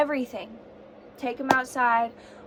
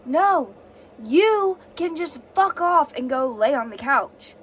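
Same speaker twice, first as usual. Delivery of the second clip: louder, slower, telling him to fuck off; angry retort; 3 semitones higher, 8 dB louder, slower than usual. Retold or imitated. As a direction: snap it back at him, loud and deliberate. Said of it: "I'm like".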